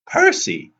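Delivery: very high, loud cry of the name; urgent call. A narrator's reading. Urgent call in speeding taxi.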